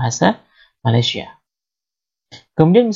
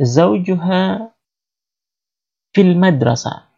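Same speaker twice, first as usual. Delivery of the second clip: slow model answer; 3 semitones higher, much slower than usual. Shown as a demonstration.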